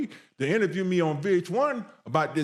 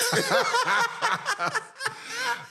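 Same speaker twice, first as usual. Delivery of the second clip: high laugh. Real, not acted. Host laughing hard.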